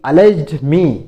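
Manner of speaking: teacher spells it out slowly for students; slow, loud model phrase. Shown as a demonstration. Teacher models English phrase slowly and loudly. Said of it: "उसने मुझ पर आरोप लगाया".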